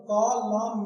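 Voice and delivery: कॉलम drawn out slowly, ending on m; silent final n. Shown as a demonstration. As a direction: stretch कॉलम as "kaw-lum", no n sound. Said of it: "लिखना है लेकिन बोलना नहीं है".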